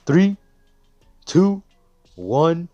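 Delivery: loud, high countdown shout; announcer counting down to the start; countdown call. Acted.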